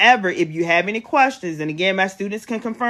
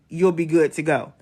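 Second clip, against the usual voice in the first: ordinary delivery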